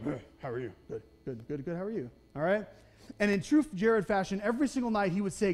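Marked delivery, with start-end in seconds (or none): 0.0-2.1 a hint of a low, grudging old man; lightly voiced gruff clerk, lower and softer; grumpy attendant. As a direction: mumble it like you'd rather not talk. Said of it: "Doesn't seem too happy about life".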